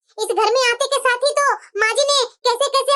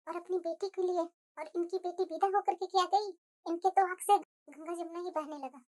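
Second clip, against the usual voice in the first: much softer, muttered to herself; bitter quiet aside; 4 semitones lower, 12 dB quieter than usual. Acted. Daughter-in-law complaining about her mother-in-law to herself, quiet bitter aside. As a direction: grumble it so the mother-in-law can't hear.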